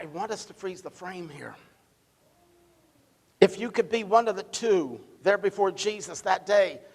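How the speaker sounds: normal talking voice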